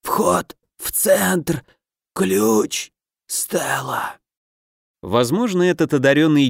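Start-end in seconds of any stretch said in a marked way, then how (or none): 0.0-2.9 very slow, strained gasps; dying last words. A narrator's reading. 3.3-4.2 last dying whisper. A narrator's reading. Dying engineer's last word.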